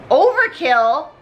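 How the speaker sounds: normal talking voice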